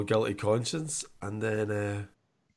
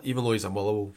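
ordinary talk, nothing marked

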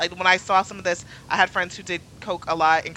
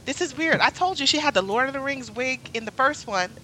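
incredulous retold outburst; 7 semitones higher, 6 dB louder than usual. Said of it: "I was like".

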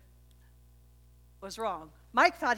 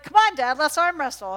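higher, louder taunting challenge; son challenging; 6 semitones higher, 11 dB louder than usual. Retold or imitated. Son daring his father to arm wrestle, loud. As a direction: egg your dad on. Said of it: "he's like".

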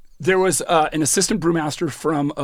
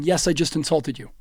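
normal talking voice

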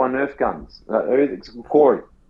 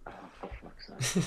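exasperated whisper; 15 dB quieter than usual. Real, not acted. Reacting to trade idea.

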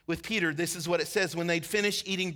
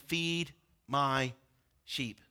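much lower and softer, trailing away; tender command; 6 semitones lower, 5 dB quieter, much slower than usual. Acted. Jesus telling Peter to feed his sheep, low and soft. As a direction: give it gently, almost a whisper.

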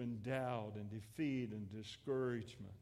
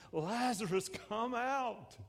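high, forceful calling-out; crying out loudly; 8 semitones higher, slower than usual. Acted. Jesus calling dead Lazarus out of the tomb, loud cry. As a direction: call it out as a command, pitched well up. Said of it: "He cried out in a li-, loud voice".

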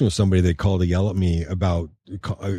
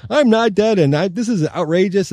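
high, indignant mock old lady voice; indignant old woman; 11 semitones higher than usual. Woman listed as deceased scolds the investor, high and indignant. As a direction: play the outraged lady who is very much alive. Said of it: "she went through the whole thing".

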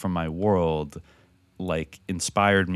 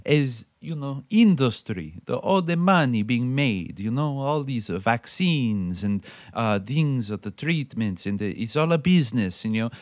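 imitating Jodorowsky; 5 semitones higher than usual. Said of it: "But he's like".

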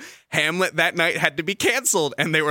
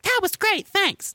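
much higher, imitating a pleased little kid; happy little kid; slower than usual. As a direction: squeak it out like a delighted child. Kid's honest praise imitated.

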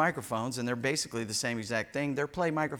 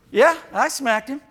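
put-on much higher, louder childish voice; mock kid voice; 12 semitones higher, 11 dB louder than usual. Pastor voicing a child admitting a hit, high kid voice. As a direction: chirp it like a proud little kid.

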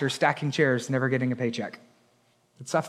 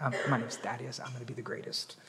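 very soft, low imitated boast; hushed bickering; 7 semitones lower, 17 dB quieter, faster than usual. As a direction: claim it secretly, almost under your breath. Disciple joins the hushed bickering.

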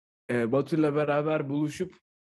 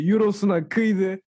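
excited; 6 semitones higher, 4 dB louder than usual. Acted. Hyping up friend's arrival.

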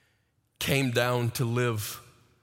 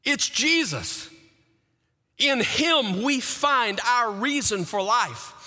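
higher, ringing sermon voice; full-force preaching; 7 semitones higher than usual. Acted. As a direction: preach it out with conviction.

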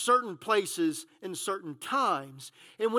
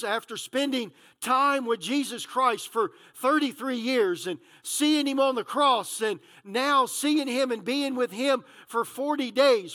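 ordinary delivery

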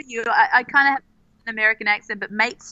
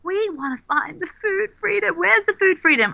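longing cry; 8 semitones higher than usual. Retold or imitated. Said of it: "we kind of was like".

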